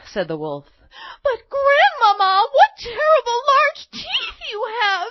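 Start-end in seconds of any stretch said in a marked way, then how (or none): 1.2-5.1 put-on very high, loud girlish squeal; alarmed little girl. A narrator's reading. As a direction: cry out in shocked amazement. Girl alarmed at wolf's teeth.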